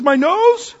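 loud, high and fast, rising in disbelief; indignant retort. Retold or imitated. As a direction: demand an answer, outraged. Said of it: "He says".

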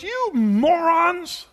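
loud, high, drawn-out insult; hammed-up furious shout; mad Moses. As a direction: yell it slowly at the crowd. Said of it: "So mad was Moses".

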